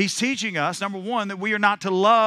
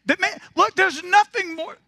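high, loud, ringing preacher's cadence; preaching at full force; 10 semitones higher, 4 dB louder than usual. Acted. Preacher firing up the congregation, full-force preaching. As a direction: preach it out to the whole room. Preacher building up his sermon.

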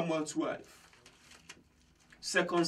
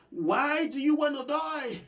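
pleading, pitched much higher; pleading cry; 10 semitones higher than usual. Acted. Mercy crying to a would-be suicide, high pleading. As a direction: cry it out as a desperate plea. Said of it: "Mercy is crying out".

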